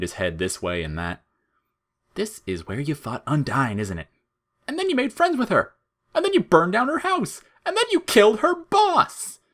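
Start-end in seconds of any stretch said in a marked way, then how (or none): 2.1-9.4 building to very high, louder mocking sing-song; smirking taunt. Flowey taunting Frisk over Undyne, smirking and climbing very high. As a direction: twist the knife, climbing higher each line. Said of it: "he smirked".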